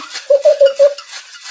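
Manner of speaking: sudden high giggle; high laugh. Real, not acted. Shaking icy cocktail shaker.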